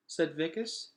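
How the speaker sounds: ordinary delivery, nothing marked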